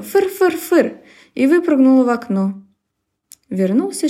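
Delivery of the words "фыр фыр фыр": high snorting fyr; cat snort. Acted. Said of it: "зафыркала".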